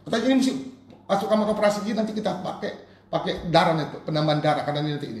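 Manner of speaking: high, loud retelling of a doctor's orders; impassioned preaching. Retold or imitated. Preacher quoting the doctor to the congregation, loud and urgent.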